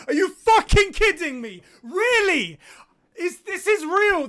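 loud, high, stunned yell; disbelieving shout. Real, not acted. Streamer reading the Snyder Cut announcement, yelling in disbelief.

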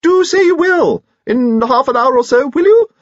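loud falsetto coaxing, rising to a squeak; squeaky pleading. A narrator's reading. Lord invites lady to supper over the band, squeaking. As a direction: plead in a very high, eager squeak. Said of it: "he squeaked".

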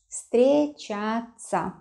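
встречаться very slowly, in separate syllables; slow, careful model of встречаться. Shown as a demonstration. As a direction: draw out each syllable of встречаться for learners. Teacher repeats new word slowly.

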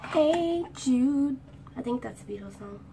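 ordinary talk, nothing marked

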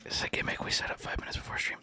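quick, hushed voiceless aside; confidential whisper. Acted. Streamer confessing the gimmick's origin to chat, quick confidential whisper. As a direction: rattle off the secret in a whisper.

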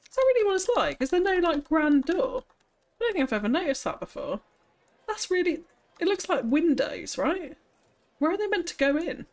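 high-pitched, baffled questioning; real disbelief. Real, not acted. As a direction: pitch right up, puzzled and incredulous. Disbelief at the casino's plain front.

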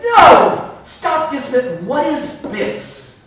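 a loud, high No, then slow astonished demand; excited shout. Retold or imitated. Stopping the winemakers mid-sentence over the brandy, a loud amazed shout. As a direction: cut them off, amazed by the brandy.

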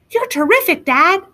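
high little dinosaur voice; Rex. A narrator's reading. Little dinosaur to his dad, high admiring voice. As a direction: gush like an admiring small child. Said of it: "said Rex".

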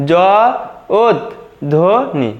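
"জ ওত ধ্বনি" with pauses between parts; syllable by syllable. Shown as a demonstration. Teacher showing the correct pronunciation, part by part. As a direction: spell out "জ ওত ধ্বনি" as jo, ot, dhoni. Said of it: "শুদ্ধ উচ্চারণটা দেখে নাও".

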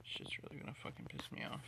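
fast, soft frustrated whisper. Real, not acted. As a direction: hiss it under the breath. Gamer muttering in frustration.

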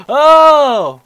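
high, loud shriek of fright; terrified scream. Real, not acted. Player hit by jump scare, terrified scream. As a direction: scream as the monster lunges.

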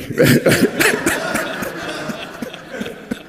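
very high, unvoiced laugh that trails off; helpless laugh. Real, not acted. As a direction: laugh through fond emotion until it fades. Laughing after sharing memory.

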